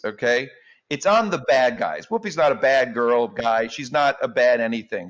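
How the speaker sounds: ordinary talk, nothing marked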